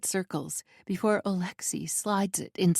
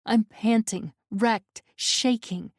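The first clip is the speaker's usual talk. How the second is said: usual speaking voice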